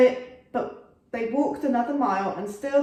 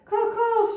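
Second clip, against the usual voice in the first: a much higher, loud crow's caw; bird screech; 12 semitones higher, 4 dB louder than usual. A narrator's reading. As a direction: screech like a wounded giant crow. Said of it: "screeched the bird".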